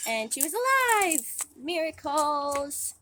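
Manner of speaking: storyteller lifting the big moment; excited reveal. A narrator's reading. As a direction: announce the miracle with a thrill. Climax of the retold miracle.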